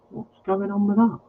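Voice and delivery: ordinary talk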